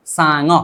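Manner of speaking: सांग run straight through as one word, "sa-ngo"; low-pitched model सांग, syllables linked; joined pronunciation. Shown as a demonstration. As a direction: say सांग smoothly joined, ng gliding into the final vowel. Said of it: "लेकिन सीधा बोलेंगे".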